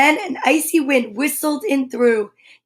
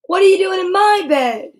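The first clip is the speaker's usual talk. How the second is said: higher, louder monster line; 6 semitones higher, 4 dB louder than usual. Acted. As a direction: roar it out once more, higher. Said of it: "repeats monster line".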